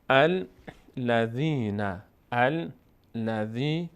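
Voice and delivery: الذین slowly recited from an "al" start; slow teaching recitation of الذین; opening fatha. Shown as a demonstration. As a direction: stretch الذین syllable by syllable. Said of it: "اگه فتحه بود".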